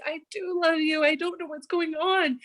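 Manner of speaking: high-pitched retold exasperation; frantic reassurance. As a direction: insist helplessly in mock panic. Retold frantic answer to companion.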